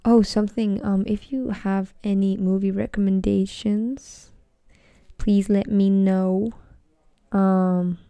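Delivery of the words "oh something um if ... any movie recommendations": ordinary delivery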